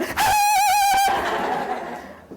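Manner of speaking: high squealing laugh at herself; bright squealing laugh. Real, not acted. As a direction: giggle up high at the silliness.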